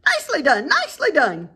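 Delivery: high, loud goose cheering; excited high goose shout; goose cries. A narrator's reading. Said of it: "cried the goose".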